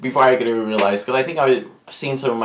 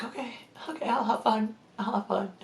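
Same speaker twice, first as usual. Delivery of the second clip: tiny, high, soft voice; meek agreement; 9 semitones higher, 12 dB quieter than usual. Retold or imitated. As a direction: agree timidly under your breath. Retold reluctant agreement.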